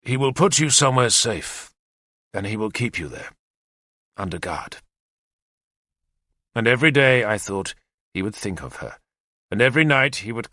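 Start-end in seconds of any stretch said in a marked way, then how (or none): 0.0-4.8 heavy. A narrator's reading. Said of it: "I said heavily".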